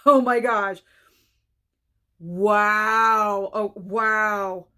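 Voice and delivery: loud, astonished exclamations; stunned amazement. Real, not acted. Reacting to [name]'s snub, loudly stunned. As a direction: let the shock burst out.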